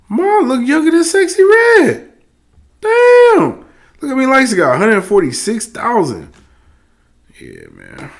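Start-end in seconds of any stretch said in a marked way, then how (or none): 0.1-3.7 high, astonished outburst; amazed. Real, not acted. Streamer gawking at a mom's photo, amazed. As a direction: pitch jumps way up in disbelief.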